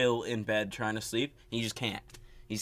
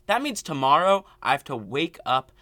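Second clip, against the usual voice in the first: ordinary talk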